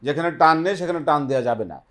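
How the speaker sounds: usual speaking voice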